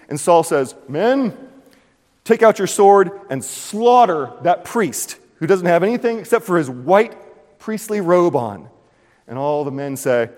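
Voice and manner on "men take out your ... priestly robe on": commanding king. Retold or imitated. King ordering slaughter.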